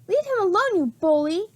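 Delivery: put-on a high, angry little girl's outcry; crying out. A narrator's reading. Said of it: "she cried".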